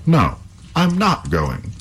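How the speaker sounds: lightly voiced stubborn hero; defiant youth. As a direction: refuse firmly, slowly. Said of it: "said Halvor".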